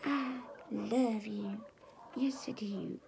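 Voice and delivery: slow, soft whisper of love; voicing the puppy to viewers, whispered. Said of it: "and say".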